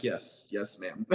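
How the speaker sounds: hushed, dutiful agreement; meekly compliant. Retold or imitated. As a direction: act out a meek yes to authority. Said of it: "I'm just like".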